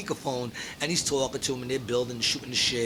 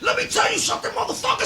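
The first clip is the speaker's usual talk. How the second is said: high mock-furious shout; Mister Watts; faster than usual. Imitating his answer to the callback, getting madder. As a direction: imitate a man fuming into the phone. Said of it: "Watts is getting more mad".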